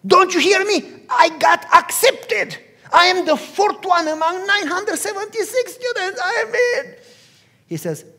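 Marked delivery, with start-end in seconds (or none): 0.1-6.9 loud, very high, insistent boasting; acted-out elated shouting, pitched right up; exasperated excitement. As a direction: shout the triumph at someone not listening. Son insists on his great news.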